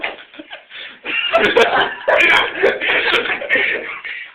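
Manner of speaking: real high, loud burst of laughter; uncontrolled high cackling. Real, not acted. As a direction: crack up loudly mid-story. Bursts out laughing mid-story.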